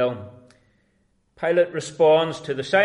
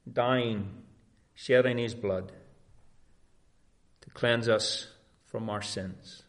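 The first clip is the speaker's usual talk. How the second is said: ordinary delivery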